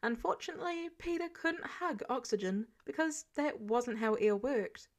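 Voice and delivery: ordinary delivery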